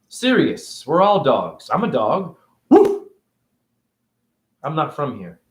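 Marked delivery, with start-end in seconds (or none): 2.7-3.1 high loud mock woof; bark. I'm a dog.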